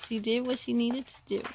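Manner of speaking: ordinary delivery, nothing marked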